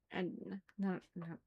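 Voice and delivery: usual speaking voice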